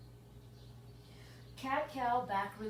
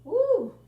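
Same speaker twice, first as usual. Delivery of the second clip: sudden high, loud whoop; woo; 11 semitones higher, 12 dB louder than usual. Real, not acted. Instructor whooping as the thigh stretch bites. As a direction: let out a real whoop at the stretch.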